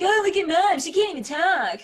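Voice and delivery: put-on high, loud teasing kid voice; mock a mother's stutter in a kid's voice. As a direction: taunt Mom like a bratty child. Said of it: "they would make fun of me".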